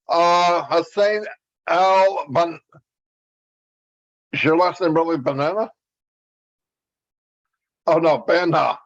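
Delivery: ordinary talk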